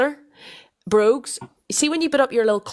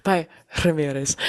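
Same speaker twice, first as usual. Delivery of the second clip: lower, put-on Spanish flair; Colombian Spanish accent; 4 semitones lower than usual. Shown as a demonstration. Presenter repeating the name for co-host, put-on Spanish accent. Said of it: "the Colombian Spanish".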